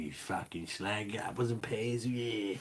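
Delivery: quiet mock Cockney, hammed up. Showing viewers his Cockney side in a mock Cockney accent. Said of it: "a bit of a sort of Cockney".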